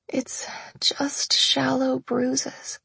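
slow, breathless croak; croaking whisper. A narrator's reading. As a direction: croak it out weakly. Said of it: "I croaked".

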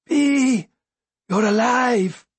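high-pitched, drawn-out exclamation; overjoyed cry. A narrator's reading. Beloved finds Bee alive, crying out high in amazement.

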